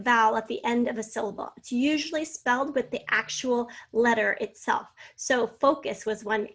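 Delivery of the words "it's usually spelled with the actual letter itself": ordinary talk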